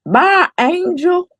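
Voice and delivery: put-on high, slow little-boy goodbye; child voice. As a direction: drawl it like a small child. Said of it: "he would say".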